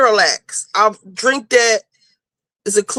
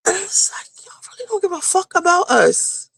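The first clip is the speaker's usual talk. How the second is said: whispered, higher bitter aside; 7 semitones higher than usual. Real, not acted. Patient complaining to listeners about doctors, whispered.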